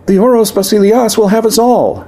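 high, thin, desperate squeal. Acted. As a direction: squeal it in terror. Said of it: "Minnie's voice rose to a thin".

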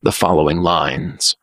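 plain reading voice

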